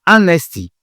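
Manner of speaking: Honesty, H muet, dit anesti; honesty sans H. Shown as a demonstration. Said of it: "C'est pas honesty, non". (Honesty prononcé anesti)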